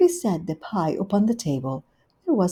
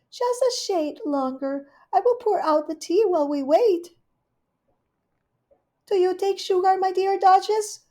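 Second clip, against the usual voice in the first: much higher, louder gushing hostess; Rivy's voice; 12 semitones higher, 3 dB louder than usual. Acted. Rivy welcoming Duchess, high gushing hostess. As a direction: greet her sweetly and grandly.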